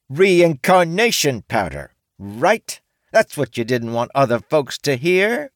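high, loud, slow on reincarnation powder; T exposing his secret to everyone; accusing orc woman. A narrator's reading.

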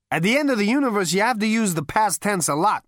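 high, loud, brash Zaphod; boastful high party voice. A narrator's reading.

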